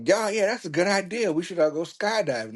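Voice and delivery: mock-enthusiastic group chiming in; excited agreement. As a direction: imitate friends jumping on the idea. Voicing excited friends agreeing.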